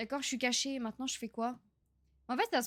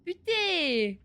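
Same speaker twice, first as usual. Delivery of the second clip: loud laughing swear, higher; laughing curse; 7 semitones higher, 6 dB louder than usual. Real, not acted. Streamer laughing at his own blunder, loud laughing curse. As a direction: burst out swearing through a laugh.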